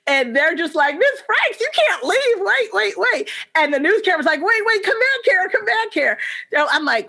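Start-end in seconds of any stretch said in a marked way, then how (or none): 1.0-3.3 put-on high child voices begging wait; imitating kids begging the fleeing teacher, high; kids pleading. 4.4-6.2 high calling come back here; imitating the news crew calling her back, high.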